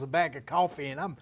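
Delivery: ordinary talk, nothing marked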